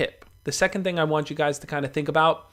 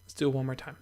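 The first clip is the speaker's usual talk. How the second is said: soft and calm; 19 dB quieter, faster than usual. Shown as a demonstration. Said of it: "let's take a deep breath together".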